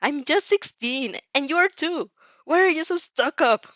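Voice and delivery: put-on whiny teen. Bratty teen shadow scolding her host, whiny. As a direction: whine it out, building up.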